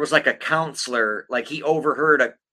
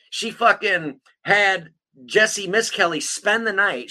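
usual speaking voice

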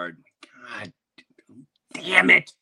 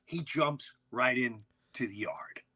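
slow whisper; hushed suspense; 6 dB quieter, slower than usual. Real, not acted. Building tension as Tommy enters the yard, whispered slowly.

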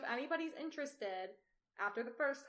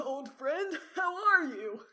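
loud, high greeting as a father character; hammed-up dad; 5 semitones higher, 8 dB louder, much slower than usual. Emperor dad greets his old friend, hammed-up loud greeting. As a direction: boom a hammed-up hello, pitched up. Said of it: "Okay, I'll do dad".

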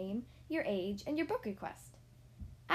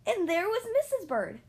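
sharp gasp, higher and louder; gasping surprise; 9 semitones higher, 8 dB louder than usual. Acted. Mister Bird finds Missus Bird in the old house, gasping surprise. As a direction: gasp at the discovery.

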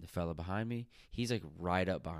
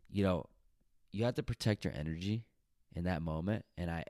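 usual speaking voice

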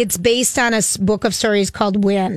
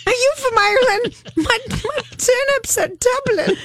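a playful, hammed-up Irish accent on a pickup line; mock Irish accent; 11 semitones higher than usual. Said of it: "Trying to be Irish".

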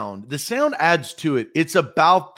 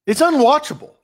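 ordinary talk, nothing marked

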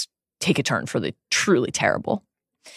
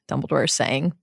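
usual speaking voice

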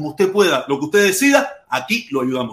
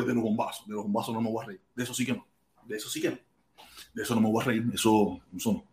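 much softer and lower, grave; quiet and serious; 10 semitones lower, 13 dB quieter, faster than usual. Real, not acted. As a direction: drop the voice, no joke here. Refuses to laugh at bombings. (en voz baja y seria, sin reírse)

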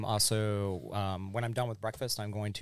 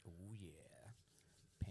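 whispered; 2 semitones lower, 24 dB quieter than usual. Real, not acted. Delighted at pancakes arriving.

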